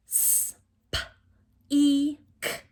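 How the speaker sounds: "S, p, e, k" as isolated sounds; sounded out. Shown as a demonstration. Teacher asks what sounds are in speak, segmented. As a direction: hiss the S, then p, e, k separately. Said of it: "What sounds do you hear in speak".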